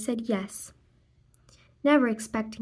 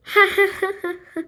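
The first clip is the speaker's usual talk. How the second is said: a high, bright burst of laughter that falls away; 8 semitones higher, 5 dB louder than usual. Real, not acted. Reader laughing to the kids at the straw hockey, high laugh. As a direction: laugh out loud, pitched up and falling.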